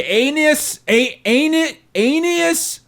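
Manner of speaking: Aeneas sounded out high and halting; struggling name. Shown as a demonstration. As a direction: stumble through Aeneas. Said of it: "try so hard to get his name right".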